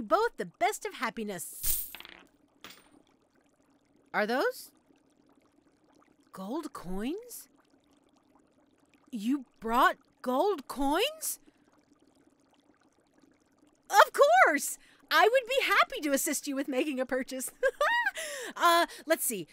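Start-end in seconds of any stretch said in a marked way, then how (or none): 4.1-7.5 tentative rising question; hesitant disbelief. Acted. Witch notices the customer's money. 9.1-11.4 very slow, louder rising question; stunned disbelief. Acted. Astonished that customer can pay. 13.9-17.5 loud, high burst of eagerness; overeager delight. Acted. Suddenly eager to make a sale. 17.5-18.5 high-pitched, overeager laugh; giddy laugh. Acted. Giddy at a possible sale.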